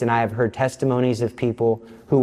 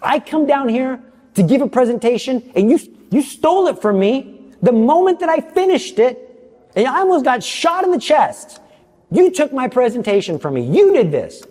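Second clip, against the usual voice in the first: angry at God; 10 semitones higher than usual. Retold or imitated. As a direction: blast it out at the Lord, furious. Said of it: "I was so angry at the Lord".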